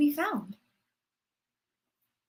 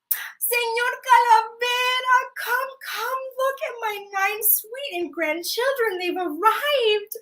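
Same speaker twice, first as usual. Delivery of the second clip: high, slow, delighted call; excited grandma; 8 semitones higher than usual. Acted. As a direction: proudly call him over. Grandma calls Death to see grandchildren.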